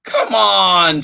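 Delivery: high, loud impatient call; shouted. Acted. Charlie calls the dog impatiently, high shout. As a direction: urge the dog to come. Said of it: "shouted Charlie".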